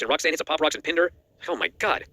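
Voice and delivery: ordinary delivery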